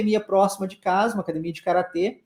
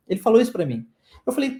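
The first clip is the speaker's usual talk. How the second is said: ordinary talk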